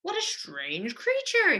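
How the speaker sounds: slow, high tiger exclaiming in wonder; tiger crying out. A narrator's reading. Tiger puzzling over the giant gourd, slow high cry. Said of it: "he cried".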